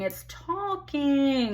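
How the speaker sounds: talking pitched high and drawn out; stress on talking. Acted. Woman correcting the word whining, high drawn-out stress on talking. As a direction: stretch "talking" as an insistent correction. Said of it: "it's not whining".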